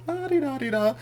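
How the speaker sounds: high singsong mock entrance; breezy Daddy entrance. Daddy just rolling in, whimsical. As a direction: lilt it high and carefree. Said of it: "now he's just rolling in like".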